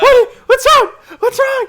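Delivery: very high, loud squealing panic through laughter; mock freaking out. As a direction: shriek it like she thinks he is dying. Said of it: "she just started freaking out".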